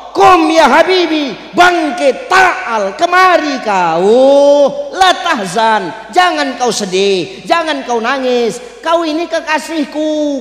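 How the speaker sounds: high, loud, commanding yet consoling call; voice of God. Acted. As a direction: call the beloved to rise, loud and slow. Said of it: "kata Allah". (suara Allah yang lantang memanggil kekasih-Nya)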